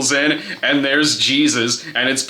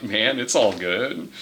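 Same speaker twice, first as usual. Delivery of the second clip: slow, low, laid-back stoner; easygoing hippie savior; hippie Jesus; 4 semitones lower, 4 dB quieter than usual. Acted. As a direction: mellow it out, slow and easy. Imagined hippie Jesus line.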